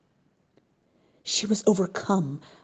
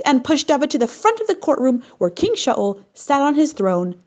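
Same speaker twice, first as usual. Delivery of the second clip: usual speaking voice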